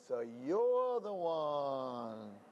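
very slow, drawn-out realization; knowing. Acted. The man telling the rabbi he takes the bread, slow knowing line. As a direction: let it dawn slowly.

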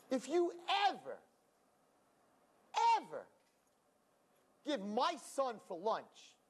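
mother-in-law laying down the law; scolding mother-in-law. Retold or imitated. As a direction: spell out each word like a warning.

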